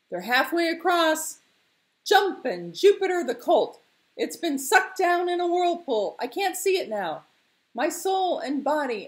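high, loud, alarmed shouts; nervous reporting. Acted. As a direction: report the danger in excited bursts. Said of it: "reporting in short, nervous sentences".

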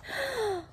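big audible gasp in; deep breath. Acted. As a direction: suck in a huge breath. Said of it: "take a deep breath".

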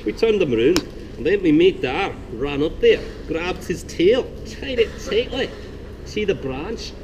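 put-on jester's joke in a soft but decisive Scottish accent. Jester telling the king a joke in a Scottish accent. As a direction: spin the yarn in a lively Scottish lilt, rising to a big finish. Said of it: "I'm gonna do a soft, but hopefully decisive Scottish dialect".

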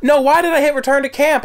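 high, loud frustrated outburst; frustrated yell. Real, not acted. Gamer hits the wrong button, loud frustrated yell. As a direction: yell at your own mistake.

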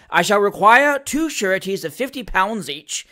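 loud, grand pronouncement; aloud, with great dignity. A narrator's reading. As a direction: proclaim it as your own ruling. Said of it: "said the magistrate aloud, with great dignity".

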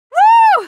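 very high, loud "woo"; exhilarated whoop. Acted. Thrilled by an awesome stunt, loud whoop. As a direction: whoop at the top of your range. Exhilarated cry in mid-action.

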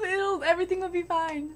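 ordinary talk, nothing marked

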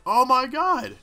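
high, loud shriek of discovery; excited realization. Real, not acted. Player shrieking as he cracks the story. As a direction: shriek it like a big reveal.

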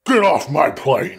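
slow, forceful action-hero growl; Harrison Ford. Acted. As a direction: order them off, drawn out and hard. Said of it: "try to do my Harrison Ford impression".

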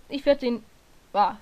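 ordinary talk, nothing marked

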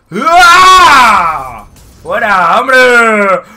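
loud triumphant yell; excited shout. Real, not acted. Gets a kill in game.

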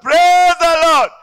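long, loud, drawn-out "Praise the Lord"; slow, high, loud church call; church shout. Retold or imitated. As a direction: bellow it like a congregation leader.

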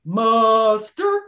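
called out loud, rising; captain's call. Shown as a demonstration. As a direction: bellow the muster call to the crew. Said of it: "let's try it at home".

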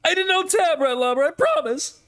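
very high whiny protest. Pretend. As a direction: whine it in a high voice. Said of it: "You sound like Clarence right now".

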